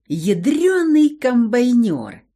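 ё in Ядрёный and комбайнёр stressed hard and slow; loud exclamation. Acted. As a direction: shout Ядрёный комбайнёр with long ё. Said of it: "ещё громче воскликнул ... ударение на букву «ё»".

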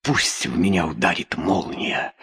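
hushed, breathless whisper into her hands. A narrator's reading. Andra alone in the storm whispering her despair. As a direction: whisper it as if to herself, barely voiced. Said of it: "шептала она".

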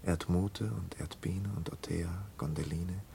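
names breathed out softly without voice; whisper. Acted. Man recalling his dead friend's names, whispering. Drama, listing remembered names.

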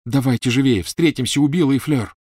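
urgent loud. A narrator's reading. Harry hurrying them.

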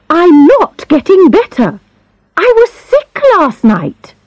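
high, insistent whine; whiny. Acted. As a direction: plead like a sick patient. Insistent sick patient.

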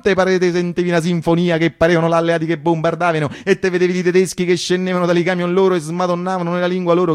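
ordinary delivery

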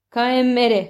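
high, loud dryad's call; exclaimed command; crying out. A narrator's reading. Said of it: "kiáltott fel a driád".

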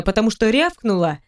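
ordinary delivery

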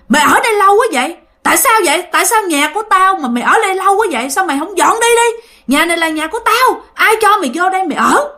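high, fast tirade of indignant questions; retold angry scolding. Ghost demands that mother leave her house, scolding angrily. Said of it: "bả chửi".

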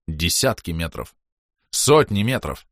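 ordinary delivery

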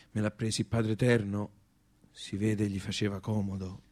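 lightly voiced sad mourner; hushed mourner. Mourner at funeral, muted.

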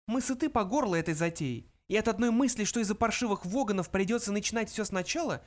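high little mouse voice; high-pitched, fed-up small creature; mouse Frankie, weary. A narrator's reading. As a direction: confide candidly as a tiny mouse.

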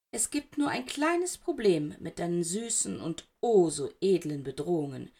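usual speaking voice

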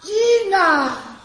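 high, loud call. Acted. Voice calls out to Gina, loud. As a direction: cry out her name. Cry of the name.

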